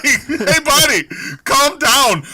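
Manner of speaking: high, loud snap at a neighbour; mock annoyed shout. Moviegoer telling the booer off, mock shout. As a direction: shush the booer sharply.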